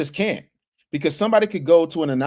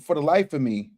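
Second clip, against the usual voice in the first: ordinary talk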